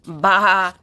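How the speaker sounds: loud, drawn-out spoken baa; sheep bleat. A narrator's reading. Sheep revealed under the clown mask, loud bleat. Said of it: "says the sheep".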